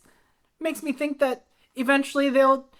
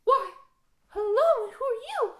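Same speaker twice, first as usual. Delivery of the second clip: put-on coy young girl; 8 semitones higher, 5 dB quieter than usual. Young Annabelle in flashback.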